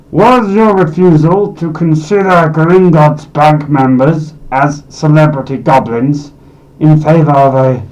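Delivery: usual speaking voice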